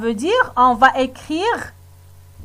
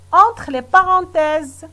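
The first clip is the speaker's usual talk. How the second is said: ordinary delivery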